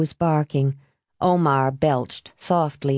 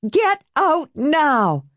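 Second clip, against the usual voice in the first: much higher, slow, every word Get out now spaced; escalated warning; 9 semitones higher, much slower than usual. Acted. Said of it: "she warned".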